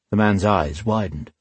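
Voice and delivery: ordinary delivery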